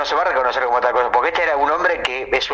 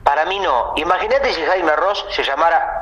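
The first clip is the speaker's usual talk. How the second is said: ordinary talk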